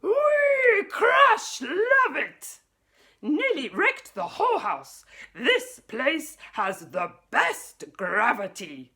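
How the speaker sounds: high, loud and slow ecstatic shout; gleeful shout. Acted. Sputnik delighted by the destruction, gleeful shout. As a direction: revel in the wreckage.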